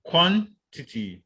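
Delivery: quantity with QUAN high, pause before tity; stress on first syllable. Shown as a demonstration. Teacher giving a first-syllable example, quantity stressed on QUAN. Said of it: "the first syllable".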